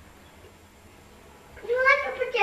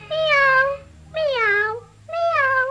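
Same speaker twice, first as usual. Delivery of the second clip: high, loud sad kitten meows; mewing kittens; kitten mews; 8 semitones higher, 6 dB louder, much slower than usual. Acted. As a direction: cry out like little kittens. Kittens crying in rhyme.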